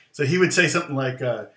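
usual speaking voice

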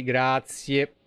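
ordinary talk, nothing marked

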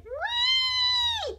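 very high squeal of joy; gleeful whee. Acted. Little bunny swung over dad's head, squealing high. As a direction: shriek with delight while swung.

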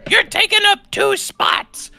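put-on high, slow, screechy old lady yelling; hammed-up high old woman voice; cranky old woman. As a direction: shriek it like an angry old neighbor. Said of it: "She goes".

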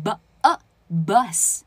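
bus built up slowly as "buh, uh, bus"; b a bus in slow steps, short neutral vowel; sound by sound. Shown as a demonstration. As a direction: break down bus with a relaxed "uh" vowel. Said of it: "你把那个b念得太霸了".

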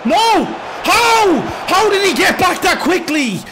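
loud, high cries of disbelief; outraged shout. Real, not acted. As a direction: yell at the screen, stunned and furious. Defender wins the ball back.

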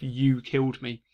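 ordinary talk

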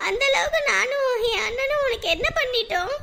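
high, tearful plea; tearful reproach. Acted. Young woman tearfully accuses the man who avoids her, high-pitched. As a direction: hold back sobs as you accuse him. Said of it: "இப்ப எதுக்கு அழுதுட்டு இருக்க".

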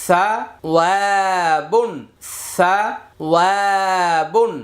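ওয়া held long between short সা and বুন; madd stretch. Shown as a demonstration. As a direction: recite syllable by syllable, drawing out ওয়া. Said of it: "একটি করে মাদের হরফ পাবো".

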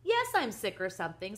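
loud, irritated snap back; savage retort. A narrator's reading. Father answers his son's question, savagely irritated. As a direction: throw the words back loudly. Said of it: "savagely grabbing a spoon".

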